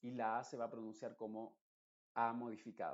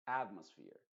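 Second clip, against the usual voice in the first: atmosphere with AT punched, sphere dropping; stress on AT; 4 semitones lower, 4 dB louder than usual. Shown as a demonstration. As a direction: punch AT in atmosphere, then fall away. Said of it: "el énfasis principal debería estar en la primera". (atmosphere marcando AT, sphere más suave)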